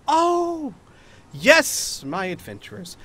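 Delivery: high, drawn-out oh, then settling; surprised recognition. Acted. Lady noticing her adventurer guests, pleasantly surprised.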